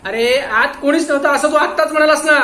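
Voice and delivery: loud, high roar of accusation. Acted. Inspector roaring at the watchman for contradicting himself. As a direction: thunder it at the liar. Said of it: "Pandit Saheb गरजले".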